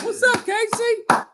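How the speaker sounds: goofy high-voiced hello to a viewer; squeaky greeting. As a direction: pipe up like a cartoon character.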